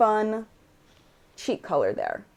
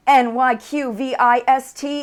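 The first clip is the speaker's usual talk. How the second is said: spelling it out; 6 semitones higher, 7 dB louder, slower than usual. Shown as a demonstration. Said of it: "doesn't have enough vowels".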